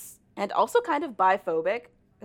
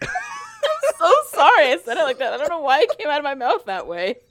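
high, loud laughing apology; 7 semitones higher, 7 dB louder than usual. Real, not acted. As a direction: apologize through giggles.

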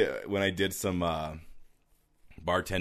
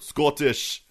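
slow, drawn-out Scottish pronunciation; Scottish accent; 4 semitones higher than usual. Shown as a demonstration. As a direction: say Scottish like a Scot. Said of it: "the only thing I can say in Scottish".

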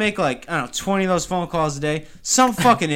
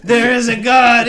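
higher, louder, slow relieved cry; slow joyful proclamation; 7 semitones higher, 7 dB louder than usual. Retold or imitated. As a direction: proclaim it like a saved man. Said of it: "I was like".

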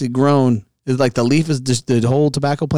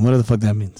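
usual speaking voice

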